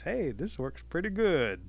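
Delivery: drawn-out, tickled storybook turtle; pleased turtle. A narrator's reading. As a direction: lightly voice a turtle pleased with his scheme.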